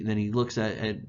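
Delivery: ordinary talk, nothing marked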